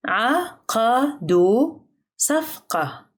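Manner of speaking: very slow "عقدوا صفقة", each syllable set apart; drawn-out teaching pace on "عقدوا صفقة". Shown as a demonstration. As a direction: sound out "عقدوا صفقة" for learners, pausing between syllables. Teacher repeats sentence slowly by syllable.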